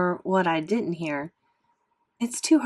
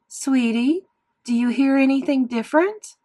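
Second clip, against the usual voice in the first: raised, higher voice of a mom speaking too loudly; 6 semitones higher, 4 dB louder than usual. Acted. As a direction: mom almost shouting at her son, louder and higher. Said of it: "You don't have to shout, Mom".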